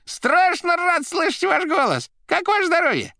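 loud, very high gushing delight; joyful shrieking greeting. A narrator's reading. The receiver delighted to hear his voice, shrieking joyfully. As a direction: gush down the phone in a squeaky voice. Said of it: "радостно вскричала трубка".

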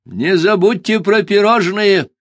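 slow, high parting call; bird parting words. Acted. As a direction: call after them like a proud bird. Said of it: "напутствовал их Куруш".

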